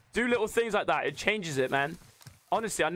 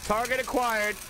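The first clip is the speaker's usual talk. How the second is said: higher, drawn-out announcer calls; mock combat announcer; 4 semitones higher, slower than usual. Player announcing a target to viewers, mock announcer voice. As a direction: announce the kill like a game system, stretching the words.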